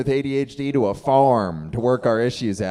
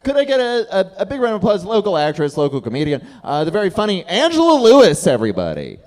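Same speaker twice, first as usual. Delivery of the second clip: emcee hype; 6 semitones higher, 5 dB louder than usual. Real, not acted. Host calls for applause.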